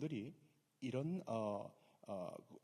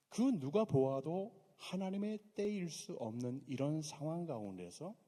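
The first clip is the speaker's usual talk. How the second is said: ordinary talk, nothing marked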